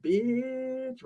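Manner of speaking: high, soft, drawn-out taunt; mocking taunt. Drinker taunting the soaked storyteller, high stretched jeer. Retold taunt with side eye.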